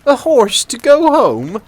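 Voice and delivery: blustering father, scandalised; indignant outburst. Acted. Father shouts at his daughter's request, indignant.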